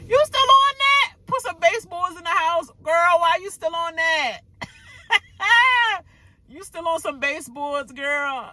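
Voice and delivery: high-pitched teasing disbelief; high, ribbing incredulity; mock incredulous. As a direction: call her out in a high, amused squeal. Teasing a cast member about baseboards.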